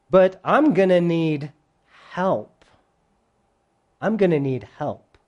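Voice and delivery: quieting, sinking into a plea; lightly voiced humility, voice dropping by the end; humble admission. Humility admits needing help to love, humbly. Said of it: "Humility agrees".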